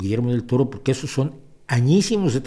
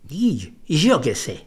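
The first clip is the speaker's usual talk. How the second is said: slow, exaggerated Buenos Aires porteño drawl; imitating a friend's thick porteño accent; 3 semitones higher, slower than usual. Friend asked why he talks that way, answers in porteño accent. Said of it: "el acento porteño más acusado del mundo".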